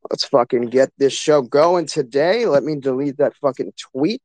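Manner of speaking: ordinary talk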